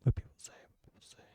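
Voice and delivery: breathy whisper to himself. Real, not acted. Whispering an aside to no one. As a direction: hush it under your breath.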